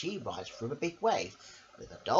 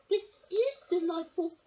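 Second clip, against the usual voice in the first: much higher, squeaky little lady voice; Missus Armitage voice. Acted. Missus Armitage asks her dog a question, high and squeaky. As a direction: pipe it up like a fussy little lady. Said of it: "said Missus Armitage".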